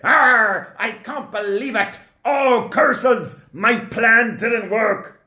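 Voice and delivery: high, growly mock pirate. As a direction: play Pharaoh as a pirate. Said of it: "I'm doing a pirate voice for Paro".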